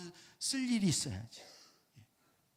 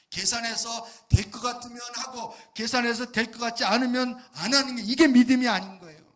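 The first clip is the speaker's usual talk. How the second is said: higher, louder preaching at full force; fiery preaching; 6 semitones higher, 12 dB louder than usual. Acted. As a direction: preach it out, building to a shout.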